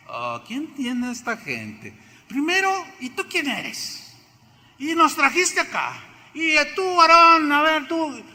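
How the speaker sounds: high, rising complaint that peaks in a shout at Aarón; mock angry Israelites, building to a yell; angry crowd quote. Voicing the angry Israelites at Moisés.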